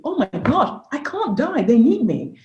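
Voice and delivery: high-pitched retold alarm; urgent realisation. Mother looking at her kids as she nearly dies, urgent and high. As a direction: relive a desperate thought, pitch jumping up.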